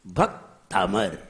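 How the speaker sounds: भक्तामर low and slow, split "bhak... taamar"; low, unforced भक्तामर in two separated parts; correct, unforced. Shown as a demonstration. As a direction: pause after bhak in भक्तामर, then a light single m. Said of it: "जोर नहीं लगाइए".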